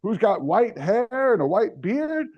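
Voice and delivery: high, sing-song ad pitch voice; hamming up a folksy fried-chicken saleswoman; mock Popeyes ad woman. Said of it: "this woman who reminds you of, like, those racist Popeyes commercials".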